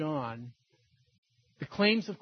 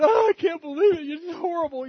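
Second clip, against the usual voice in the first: high-pitched mock wail of reproach; imitated crying; 10 semitones higher, 7 dB louder than usual. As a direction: play a hammed-up tearful accusation. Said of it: "and then she's crying".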